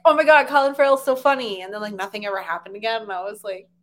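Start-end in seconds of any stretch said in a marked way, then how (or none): 0.0-1.6 loud imitation of gushing fans; mock audience rave, pushed louder. People reacting to Horrible Bosses, gushing.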